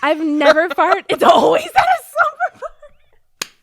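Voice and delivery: loud laughing mimic; laughing shout. Laughing loud repeat of friend.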